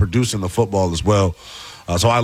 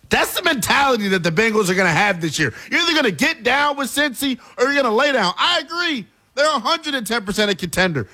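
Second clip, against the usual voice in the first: high, fired-up sports argument; fired-up hype; 9 semitones higher than usual. Real, not acted. Hyping the Bengals to cohost, fired up. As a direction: pitch it way up and push the case.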